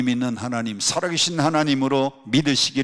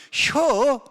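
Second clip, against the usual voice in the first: high, loud one-syllable Chungcheong reply; quick Chungcheong dialect; 13 semitones higher, 5 dB louder than usual. Shown as a demonstration. Preacher showing how briefly Chungcheong people answer, quick Chungcheong dialect. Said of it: "충청도 사람들은 얼마나 빠른지".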